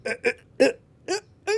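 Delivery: mock climax grunts building up; hammed-up rising moans; panting moans. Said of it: "he starts going like".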